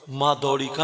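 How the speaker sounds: ordinary talk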